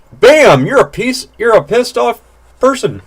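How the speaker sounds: a pitch jump way up into mock anger; sudden mock anger. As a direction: act out a mood flipping to rage.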